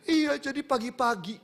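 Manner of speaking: high, slow, drawn-out eager reply; overjoyed wife, imitated. Wife telling the pastor what happened that morning, imitated high excitement. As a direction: imitate her bursting to tell it.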